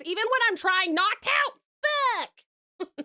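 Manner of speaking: loud, high, drawn-out protest; exasperated outburst. Real, not acted. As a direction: wail it in comic frustration, then curse. Mock outrage at growing accent.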